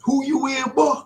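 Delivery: high, put-on questioning voice; high-pitched Halloween heckler; nosy questioner. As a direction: pipe up with a challenge at the door. Acted Halloween questioner at door.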